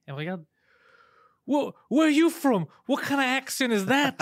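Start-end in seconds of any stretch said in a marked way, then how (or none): 1.5-4.2 high, loud mock West Virginia accent; strong West Virginia accent. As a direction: imitate a local's thick West Virginia accent, pitched way up. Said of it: "son accent était fucking fort".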